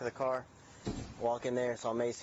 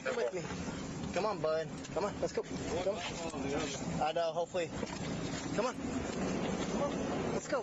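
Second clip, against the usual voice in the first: talking to a dog; 6 semitones higher, slower than usual. Real, not acted. Coaxing a dog to come.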